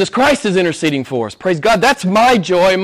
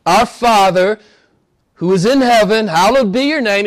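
higher, louder, slow start; prayerful recitation; 6 semitones higher, 5 dB louder than usual. Acted. Preacher reciting the Lord's Prayer, raised and reverent. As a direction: lift the words up as prayer. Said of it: "Jesus says".

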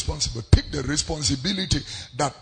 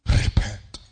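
hushed repent; 9 semitones lower, slower than usual. Acted. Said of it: "the message".

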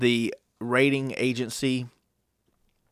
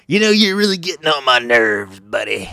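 louder, irritated dig at the other parent; co-parent snapping at the other parent during drop-off; annoyed jab; 7 dB louder than usual. Retold or imitated. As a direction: needle him with a sneer.

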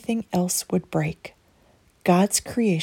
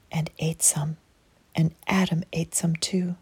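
ordinary delivery

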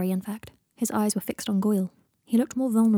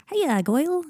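awkward chirpy greeting; 8 semitones higher, 3 dB louder, slower than usual. Acted. Nerdy awkward greeting wave.

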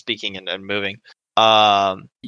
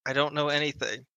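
quiet denial; 7 dB quieter than usual. Acted. NPC denies, softer.

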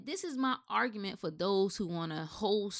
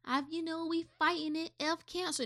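high, soft, smug imitation of a cancer-walk supporter; mock do-gooder voice; 7 semitones higher, 6 dB quieter than usual. Vlogger mocking charity walkers in a high, soft voice. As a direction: mimic the self-satisfied feel-good crowd.

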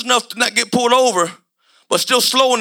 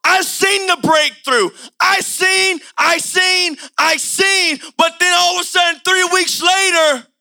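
rising preaching; 5 semitones higher than usual. Acted. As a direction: hammer each phrase higher. Preaching build again.

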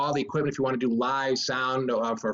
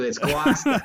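laughing Boston accent, higher and louder; 8 semitones higher, 4 dB louder than usual. Shown as a demonstration. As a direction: drop the R, Boston style, laughing. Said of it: "hit it with the Boston accent".

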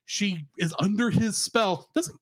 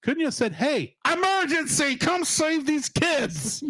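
mock urgent plea; 7 semitones higher, slower than usual. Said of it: "And I'm sitting there going".

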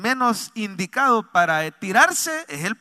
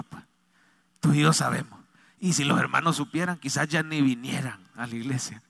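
humble prayer; 3 semitones lower than usual. Retold or imitated. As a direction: confess privately, voice dropped. Re-enacts his humble prayer.

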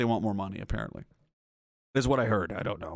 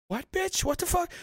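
high squeaky mock disbelief; very high-pitched inner protest; incredulous outburst. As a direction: squeal it in retold outrage. Retold inner outrage at the clerk.

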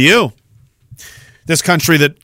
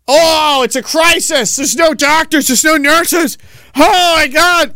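mock panicked officials; 10 semitones higher, slower than usual. Nova Scotia officials complaining about no doctors, hammed-up panic. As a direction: ham up helpless government worry.

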